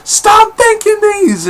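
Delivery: Jean's shrill cry. Retold or imitated. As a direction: cry out high, begging him to stop. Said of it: "And she's like".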